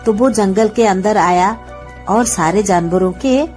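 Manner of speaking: plain reading voice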